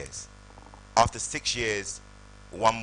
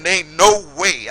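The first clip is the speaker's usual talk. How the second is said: much higher, louder and slow; stunned slow shout; 10 semitones higher, 18 dB louder than usual. Retold or imitated. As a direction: drag it out, shouting in disbelief. Disbelieving shout at agent's news.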